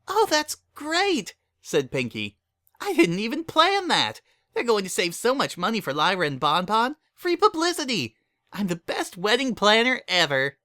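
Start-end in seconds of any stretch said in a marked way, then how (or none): 0.1-1.3 high, slow bubbly pony voice; squeaky cheerful pony; high excited Pinkie voice; Pinkie's voice. A narrator's reading. 2.8-10.6 squeaky cheerful pony; high excited Pinkie voice; Pinkie's voice. A narrator's reading.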